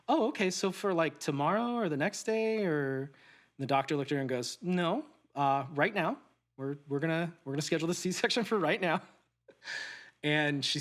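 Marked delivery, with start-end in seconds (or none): 0.1-3.1 high, bright-eyed naive question; sweet wife asking the doctor if surgery is tomorrow, naive and high; innocent wife. Retold or imitated. Naive wife voiced in story. 4.6-9.0 firm doctor. Retold or imitated. Doctor voiced in story.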